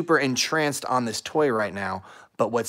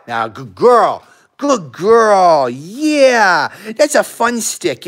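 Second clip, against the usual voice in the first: higher and louder, bubbly praise for the dog; high-pitched energetic dog talk; 5 semitones higher, 8 dB louder, much slower than usual. Real, not acted. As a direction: hype the dog up with a high, excited voice. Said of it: "talk with your dogs like this, have a high-pitched voice, and kinda be energetic".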